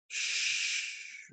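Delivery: a whispered, breathy shh; soft shush. A narrator's reading. Cow hushes the hen beside the sleeping calf, whispered. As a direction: hush her gently so the calf sleeps. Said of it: "mooed Clarissa softly".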